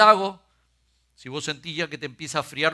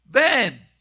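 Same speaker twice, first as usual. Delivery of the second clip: Jesus calling; 8 semitones higher, 9 dB louder than usual. A narrator's reading. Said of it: "Jesús le dijo".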